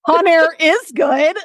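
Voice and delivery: laughs through the words, slow and high; laughing indignation; laughing protest. Real, not acted. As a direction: defend the movie while cracking up. Defending Con Air, laughing.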